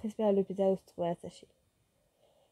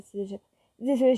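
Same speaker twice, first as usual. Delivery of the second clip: ordinary delivery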